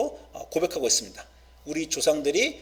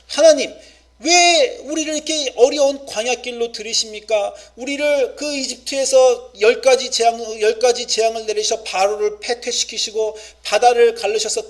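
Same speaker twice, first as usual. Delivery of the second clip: loud, higher pleading cry to God; raised, pleading voice of Israel in the desert; impassioned lament; 6 semitones higher, 5 dB louder than usual. Acted. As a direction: cry out to God as a wounded people.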